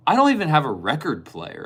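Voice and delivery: high, loud, sheepish complaint; whiny confession. Retold or imitated. Friend explaining he owns no record player, high sheepish whine. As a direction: act out the friend's clueless excuse.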